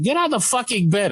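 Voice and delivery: exasperated order. Retold or imitated. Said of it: "orderly insists".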